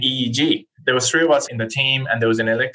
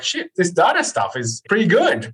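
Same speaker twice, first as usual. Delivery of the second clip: impressed realization; 7 semitones higher than usual. Retold or imitated. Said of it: "I was like".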